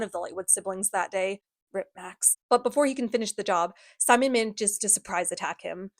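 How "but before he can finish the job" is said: ordinary talk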